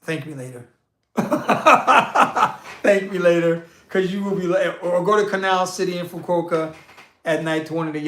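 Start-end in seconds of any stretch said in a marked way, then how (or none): 1.1-2.8 high, loud burst of laughter. Real, not acted. Cracking himself up over his travel tip, loud laugh. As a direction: break out in a hearty cackle.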